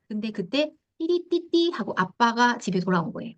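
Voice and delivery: normal talking voice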